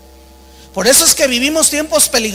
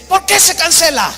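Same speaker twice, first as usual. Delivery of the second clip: raised, higher-pitched mock demand; acted indignant demand; 6 semitones higher than usual. Passenger banging on the airline desk, acted indignant demand. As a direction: imitate a passenger demanding an explanation. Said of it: "Entonces golpea la, la, el escritorio".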